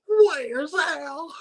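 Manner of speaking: high, panicked voice after a gasp; shrill it out like a woman in a panic; frantic girlfriend. Retold or imitated. Dumped girlfriend bursting in looking for him, high panicked voice.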